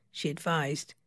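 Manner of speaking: usual speaking voice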